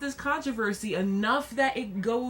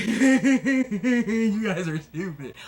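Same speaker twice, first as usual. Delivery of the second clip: slow, louder, laughing through it; laughing scorn; 6 dB louder, much slower than usual. Retold or imitated. Vlogger laughing at outraged commenters, amused scorn. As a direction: chuckle at their stupidity. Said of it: "I'm sitting here like".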